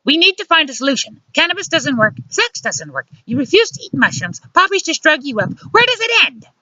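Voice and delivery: high, urgent female voice; exasperated scolding. A narrator's reading. Luciana demands they find a solution, exasperated scolding. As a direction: rattle off complaints, rising to the last question. Luciana confronts him in bedroom.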